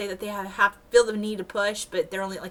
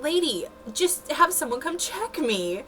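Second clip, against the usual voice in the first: exasperated; 7 semitones higher than usual. Retold or imitated. Exasperated patient to cranky nurse.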